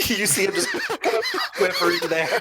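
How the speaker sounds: laughing. Real, not acted. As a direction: narrate it while cracking up. Table cracks up at the scene.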